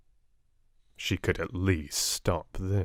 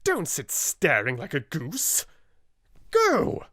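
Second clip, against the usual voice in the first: fierce; 7 semitones higher than usual. Acted. Miss Minchin orders her sister out, fierce. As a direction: drive her out of the room. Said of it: "was the fierce answer".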